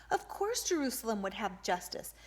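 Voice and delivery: usual speaking voice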